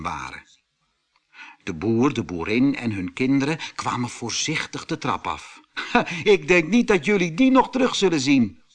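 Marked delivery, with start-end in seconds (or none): 5.9-8.6 laughing. A narrator's reading. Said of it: "lachte Otto".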